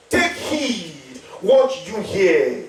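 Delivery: slow, drawn-out 'Take heed what you hear'; solemn scripture reading. Acted. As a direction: read each word slowly.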